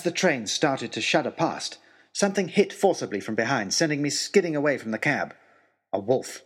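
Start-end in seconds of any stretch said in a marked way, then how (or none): none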